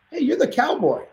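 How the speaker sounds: high, delighted recognition; light up as you realise; excited. Retold or imitated. Recognizing the star.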